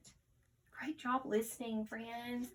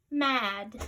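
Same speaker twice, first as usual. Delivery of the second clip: usual speaking voice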